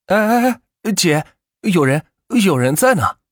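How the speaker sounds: startled, high-starting reminder; flustered warning. A narrator's reading. Passenger warning a breastfeeding mother, flustered. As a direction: stammer out an embarrassed alert. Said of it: "吓得万钱赶紧扭过头去提醒道".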